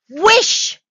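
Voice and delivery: full shout, high and rising; strong-voiced cry. A narrator's reading. Wife commanding her frightened husband, strong loud cry. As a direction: shout the command with all your force. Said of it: "she cried in a strong voice".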